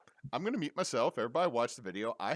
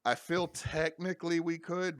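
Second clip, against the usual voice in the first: hiccups; 8 semitones higher, 4 dB louder than usual. Real, not acted. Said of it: "I got the hiccups there".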